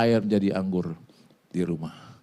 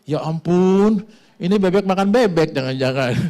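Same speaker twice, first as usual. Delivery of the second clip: joking exclamation; 8 semitones higher, 8 dB louder than usual. Real, not acted.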